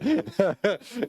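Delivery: a quick rising giggle; light amused giggle; short laugh. Real, not acted. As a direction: laugh again at the joke. Laughs at the answer.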